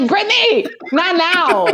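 high-pitched comic plea to hold off; mock exasperated. Host telling [name] to wait, mock exasperated.

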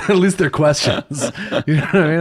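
chuckling through the words; laughing. Real, not acted. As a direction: laugh while you say it. Laughing at AI questions.

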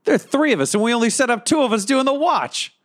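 high-pitched in-character grumble to oneself; high put-on voice, cursing to yourself; grumbling to oneself. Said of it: "turn to myself in the corner and go".

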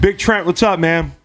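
high hearty hello; excited greeting. Real, not acted. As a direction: greet him big and bright. Calling greeting to guest.

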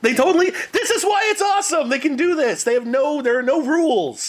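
high, laughing excited exclamation. Real, not acted. Actor tells the podcast hosts why porn parodies are great, excited. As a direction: gush about it with real glee. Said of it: "excited about porn crossovers".

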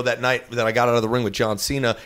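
normal talking voice

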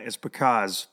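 ordinary talk, nothing marked

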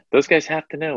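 "Those guys have to know" jumped up high in pitch; high mock echo. Shown as a demonstration. Echoing own line to the co-host, pitched high.

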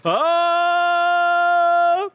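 long, high, loud drawn-out oh; mock surprise. Real, not acted. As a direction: fake astonishment at the count. Reacting to seven items.

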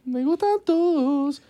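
high, slow put-on flirt voice; mock seductive voice. Friends parodying flirty texts, high slow mock flirt. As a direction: coo it like a cheesy seducer.

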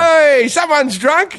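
high, slow, jolly teasing call; happy cheer. Retold or imitated. Cheerily greeting a drunk who stumbled into him, happy cheer. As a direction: cheer the drunk along merrily. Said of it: "like a, like a happy".

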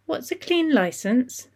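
put-on high, slow, wondering little girl; curious child. Alia asking her father from the floor, innocently curious. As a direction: ask it like a puzzled small child. Young daughter asks innocent question.

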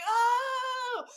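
high mock crowd "Oh!"; meme "Oh!". Imitating the crowd meme, a high shouted "Oh!". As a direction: throw up a big high "Oh!" like the meme. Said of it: "Like that meme of".

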